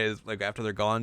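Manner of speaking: normal talking voice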